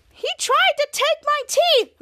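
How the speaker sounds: high, slow, drawn-out cry; hammed-up indignant wail; outraged accusation. Fighter accusing someone mid-brawl, outraged.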